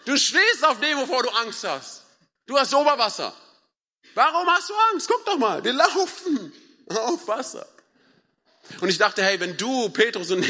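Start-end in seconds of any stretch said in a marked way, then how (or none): 0.0-7.7 high, loud, excited disbelief; incredulous buddy. Acted. Jesus to Petrus walking on water, incredulous buddy. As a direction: cheer a friend on, voice way up.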